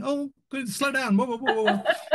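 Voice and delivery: high, laughing whoa-whoa, reining someone in; laughing mock panic. Retelling a healed man stamping round the room, laughing mock panic. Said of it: "panicking, going".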